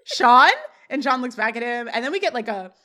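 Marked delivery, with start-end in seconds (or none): 0.0-0.5 loud, rising question in hammed-up shock; mock disbelief. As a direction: ask it loudly, as if stunned. Corey's shock retold.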